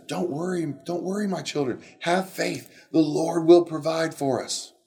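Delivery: put-on calm the frightened children; soothing Moses. Moses reassures people in quote.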